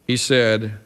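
normal talking voice

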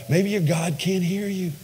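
high, softly taunting suggestion; mock trash talk. Elijah taunting the prophets of Baal, mock concern. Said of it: "he starts trash talking them".

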